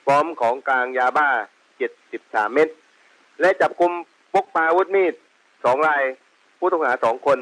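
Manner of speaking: radio transmission voice; over walkie talkie. Real, not acted. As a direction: speak through a handheld police radio. Field unit reports over radio. (เสียงผ่านวิทยุสื่อสาร)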